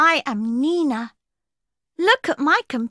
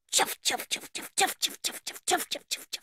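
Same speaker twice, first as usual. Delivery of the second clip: whispered train chuff, fading softer; 4 semitones higher, 10 dB quieter, faster than usual. Acted. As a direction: puff it out like a steam train. Toy train sound effect.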